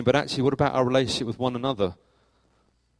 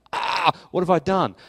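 raised-pitch cry of mild mock dread; mock panic; 6 semitones higher, 4 dB louder than usual. Teacher called for a quick word by the headteacher, mock panic. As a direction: act out a sudden fright, lightly.

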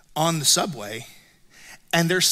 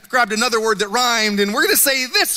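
flustered cover-up; 6 semitones higher, 4 dB louder than usual. Retold or imitated. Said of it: "And then I'm like".

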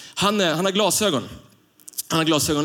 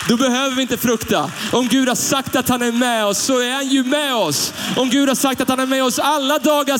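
high, loud, rising preacher's fervour; preaching at full force; 7 semitones higher, 3 dB louder than usual. Acted. Pastor thunders to the congregation, full preaching force. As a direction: proclaim it to the whole church. Preacher drives the point home.

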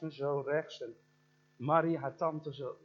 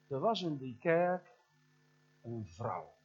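ordinary talk, nothing marked

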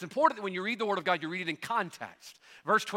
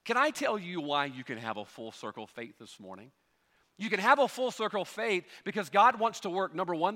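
normal talking voice

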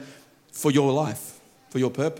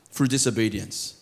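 ordinary delivery

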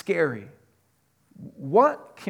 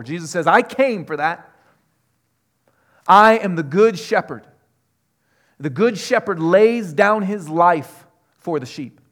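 loud, high preaching of the Jesus quotes; forceful preaching; 4 semitones higher, 8 dB louder than usual. Acted. Pastor proclaiming Jesus' words to the congregation, loud and high. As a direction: proclaim it to the church.

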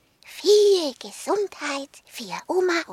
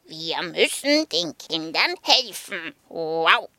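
low woofing dog; 8 semitones lower than usual. Acted. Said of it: "meinte Sokrates".

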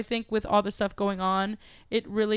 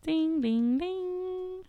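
ding ding chime; 9 semitones higher, 4 dB quieter, much slower than usual. Pretend. Segment bell imitation.

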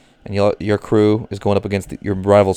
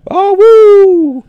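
wolf howl; 10 dB louder than usual. Pretend. The loud high wolf howl.

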